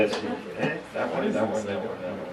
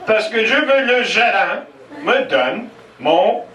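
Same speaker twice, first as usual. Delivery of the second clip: indignant shout; 9 semitones higher, slower than usual. Retold or imitated. Brother-in-law insisting to the waitress, rising to an outraged high shout. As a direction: whine it out and explode on the end. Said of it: "has a famous Irish temper".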